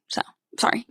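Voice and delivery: breathy whispered apology; hushed aside while on a call. Real, not acted. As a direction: whisper it off to the side.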